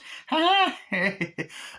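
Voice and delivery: high-pitched mock villain cackle; imitated cackle. Chucky while he's taking photos, high imitated cackle. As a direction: cackle high like a mischievous doll.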